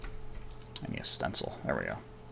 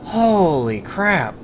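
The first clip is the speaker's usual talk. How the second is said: amazed; 10 semitones higher than usual. Real, not acted. Storm tearing up the trees.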